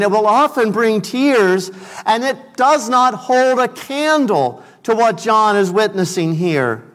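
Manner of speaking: usual speaking voice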